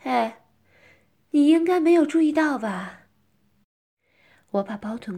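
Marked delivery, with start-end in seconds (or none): 0.0-3.1 higher, much louder taunting question; raised teasing voice. Acted. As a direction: call it out teasingly. Continues taunting the other character.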